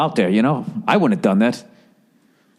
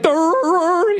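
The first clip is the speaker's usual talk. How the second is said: silly whale noise. Retold or imitated. Imitating the speaker's whale noise.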